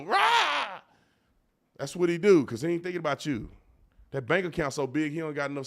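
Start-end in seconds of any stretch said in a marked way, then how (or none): 0.0-0.8 high yelled "Rah!" mimicking a hyped billionaire; mock stage roar. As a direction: let out a goofy stage roar. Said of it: "to go on stage and go".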